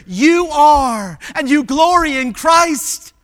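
loud, high, drawn-out affirmation; impassioned father. Acted. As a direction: build to a ringing assurance for his children. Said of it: "coming up to his kids".

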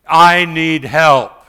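loud, slow plea for help; desperate loud appeal; crying out to God. Acted. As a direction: cry it out, drawn long and loud. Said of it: "when I cry out".

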